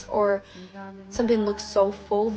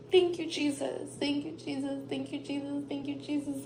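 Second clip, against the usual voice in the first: softer and softer, breathless real thanks; hushed prayer; 3 semitones higher, 7 dB quieter than usual. Real, not acted. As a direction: murmur it to Jesus, fading away. Overwhelmed private thanks to Jesus.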